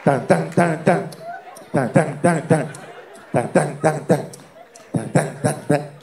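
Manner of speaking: even, percussive drum beats by mouth; imitated drums. Man starts hearing cannibal drums, imitated drumbeat. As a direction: beat out short repeated drum hits. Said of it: "empezó a sentir los tambores".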